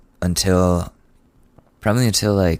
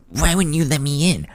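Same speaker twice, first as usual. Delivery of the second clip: mom's voice; 7 semitones higher than usual. Retold or imitated. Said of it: "She's like".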